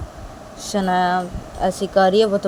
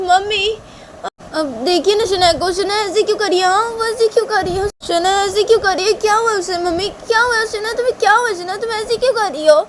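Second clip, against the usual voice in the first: high, loud, frantic complaint; high-pitched worked-up daughter; distressed Tisha; 14 semitones higher, 5 dB louder than usual. Acted. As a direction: plead with mother in a high, pushed voice. Tisha complains to her mother.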